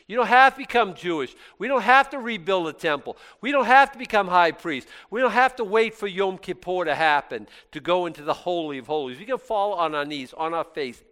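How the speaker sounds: loud, high preaching build. Acted. Preacher rousing the congregation, loud and high. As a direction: preach it out with full force.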